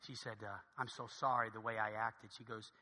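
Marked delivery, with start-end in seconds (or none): 0.8-2.3 low, hushed contrite apology; soft apology. Acted. Gate agent sorry for snapping at passenger, soft apology.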